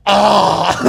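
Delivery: loud, high hammed-up "Ah!"; mock noisy outburst; exaggerated congestion noise. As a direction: mimic a noisy sniffler, way over the top. Imitating his own loud sniffling on plane.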